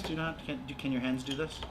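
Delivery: usual speaking voice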